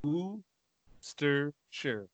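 Worcestershire said very slowly, as USS-ter-sher; slow careful attempt. Shown as a demonstration. Friend trying hard after the demo, very slow. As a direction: drag out Worcestershire syllable by syllable. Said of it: "Say it like that".

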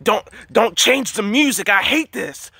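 high, agitated villain voice; strained, high-pitched complaint; Lucifer. Retold or imitated. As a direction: imitate a riled-up devil protesting. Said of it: "then I hear Lucifer again".

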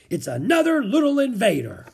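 high, loud merry boom; booming, jolly. A narrator's reading. Monk greets Jack at the clifftop, booming jolly. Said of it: "boomed a deep, jolly voice".

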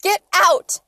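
high, very loud furious order; full-voiced angry yell. A narrator's reading. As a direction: shout them out of the house. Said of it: "Mom yelled".

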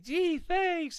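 high, loud, mock-grateful squeak; sarcastic high thanks. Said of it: "And you were like".

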